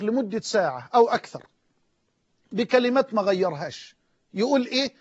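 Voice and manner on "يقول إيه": ordinary talk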